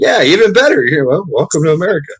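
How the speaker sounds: high, loud teasing welcome. Retold or imitated. American welcoming the Brit, high teasing. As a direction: rub it in cheerfully. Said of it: "I was like".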